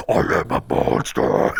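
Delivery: low robotic monster voice, whispered; metal voice. Retold or imitated. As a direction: growl it like a metal announcer. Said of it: "the metal voice going".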